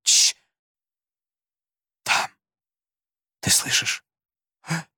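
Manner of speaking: tense hushed whisper. A narrator's reading. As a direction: hush it, listening hard. Boys hiding, hearing footsteps. (напряжённый шёпот)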